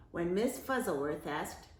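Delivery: ordinary delivery, nothing marked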